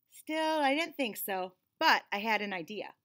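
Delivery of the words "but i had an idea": ordinary delivery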